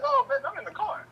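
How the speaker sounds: squeaky high imitation of mom on the phone. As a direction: pitch it way up like mom. Mother voiced in retold call.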